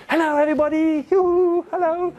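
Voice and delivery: very high sing-song hello, loud on the yoo-hoo; over-the-top greeting. Shown as a demonstration. Presenter hailing a corporate room in an over-the-top, very high voice. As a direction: trill it out in a very high, held pitch. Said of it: "If you do a corporate presentation and go".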